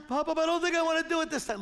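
put-on high, fast child's voice backing out; reluctant little girl. Granddaughter telling grandpa she won't slide again, high and quick. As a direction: rattle it off like a nervous kid.

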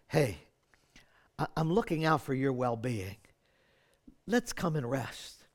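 gentle Jesus. Retold or imitated.

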